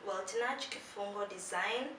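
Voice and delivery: ordinary talk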